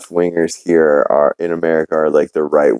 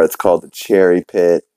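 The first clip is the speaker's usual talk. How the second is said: ordinary talk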